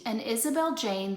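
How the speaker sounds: ordinary delivery, nothing marked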